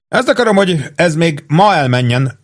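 a cheerful captain giving orders. A narrator's reading. Captain to his sergeant, cheerfully. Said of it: "folytatta jókedvűen".

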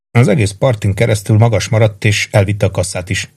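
ordinary delivery, nothing marked

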